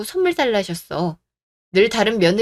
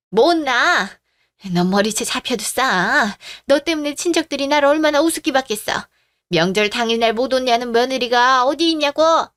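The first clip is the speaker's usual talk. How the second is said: heated, raised-voice tirade; angry scolding; 3 semitones higher than usual. Acted. Mother-in-law pulling daughter-in-law's hair over the holiday absence, angrily scolding. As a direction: berate her with rising indignation.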